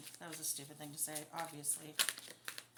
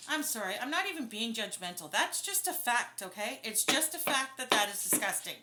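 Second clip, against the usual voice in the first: real indignation, raised voice; getting upset; 2 semitones higher, 6 dB louder than usual. Real, not acted. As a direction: let your outrage spill over. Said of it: "I'm getting upset".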